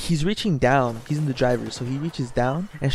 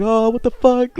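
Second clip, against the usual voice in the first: higher, louder startled outburst; mock panicked yelp of alarm; imitating scared driver; 9 semitones higher, 8 dB louder than usual. Driver gripping his wheel after the rock hit, mock scared. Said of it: "Like, he was scared".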